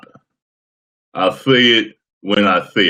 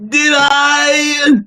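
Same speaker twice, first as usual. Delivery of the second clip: slow, much higher shouted Flair-style bellow; Jay Lethal doing Ric Flair; 6 dB louder, much slower than usual. Retold or imitated. Imitating a wrestler doing Ric Flair, drawn-out higher shout. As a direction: stretch it out into a big wrestling promo yell. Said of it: "Here's an impression of an impression".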